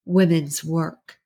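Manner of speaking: drawn-out mocking quote, very slow; sarcastic air quotes. A narrator's reading. Neighbour mocking the husband's phrase, slow and sarcastic. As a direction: say it as if quoting someone you despise. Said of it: "she used air quotes".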